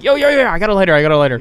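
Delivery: put-on high, loud eager kid shouting; mock eager shout. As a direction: yo yo yo, show off the lighter. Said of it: "So you were like".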